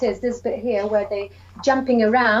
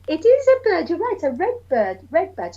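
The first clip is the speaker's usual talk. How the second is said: put-on higher, bright praise for the kids; excited praise; 7 semitones higher than usual. Shown as a demonstration. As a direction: cheer the class on. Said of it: "teacher praising children".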